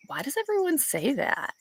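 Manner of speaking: mock exasperated, pitched well up; exasperated retort. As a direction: toss it off like you've heard it a hundred times. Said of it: "And I'm like".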